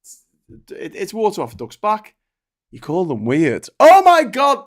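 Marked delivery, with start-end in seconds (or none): 3.8-4.6 loud, high burst of mock shock; mock outrage. Host mocking how Republicans react to being called weird, loud mock shock. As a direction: throw up your hands in mock horror.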